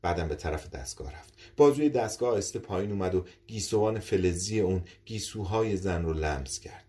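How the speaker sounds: ordinary delivery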